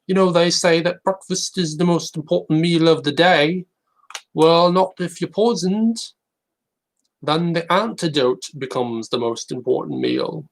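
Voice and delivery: slow, high put-on British accent for jokes. Telling jokes as a talent-show contestant, British accent. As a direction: deliver one-liners in a hammy British accent. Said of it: "how I'd talk if I was doing Britain's Got Talent".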